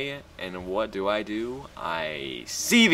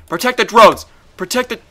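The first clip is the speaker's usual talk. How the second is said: loud, much higher alarm cries; urgent shouting; 13 semitones higher, 13 dB louder than usual. Real, not acted. Gamer panicking over his drones, urgent shout. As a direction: yell it like a warning.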